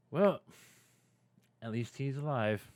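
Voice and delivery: grudging, deflated tone; disappointed. Acted. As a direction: sound let down he survived. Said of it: "sound incredibly disappointed".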